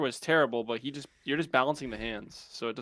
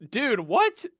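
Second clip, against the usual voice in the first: surprised; 11 semitones higher, 4 dB louder than usual. Real, not acted. Shocked they're already in raid.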